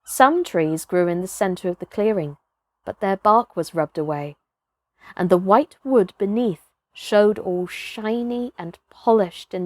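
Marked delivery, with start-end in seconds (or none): none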